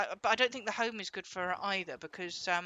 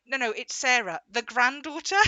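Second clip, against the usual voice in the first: louder, insistent no, no; insistent correction; 3 semitones higher, 8 dB louder than usual. Retold or imitated. Granddaughter correcting grandma insistently, louder. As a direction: insist firmly on who you are.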